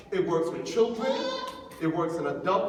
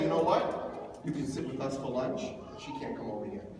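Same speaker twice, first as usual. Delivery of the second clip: catty; 9 semitones lower, 7 dB quieter than usual. Retold or imitated. Mean girls excluding another girl.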